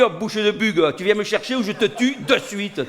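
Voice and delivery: hammed-up impatient threat; mock threat. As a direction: scold a late friend with a playful threat. Threatening a friend on the phone.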